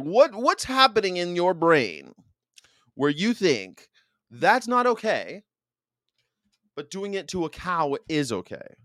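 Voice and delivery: acted-out moral outrage; retold scolding.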